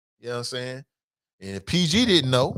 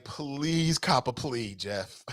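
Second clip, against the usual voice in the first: slow, soft pleading plea trailing into a laugh; pleading mock Sam; 2 semitones lower, 6 dB quieter, much slower than usual. Sam begging Jeff to take a plea, soft mock pleading. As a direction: beg him slowly, half laughing. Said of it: "I bet Sam was like".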